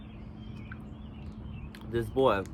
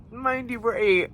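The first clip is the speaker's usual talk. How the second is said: incredulous; 9 semitones higher than usual. Real, not acted. High incredulous emphasis.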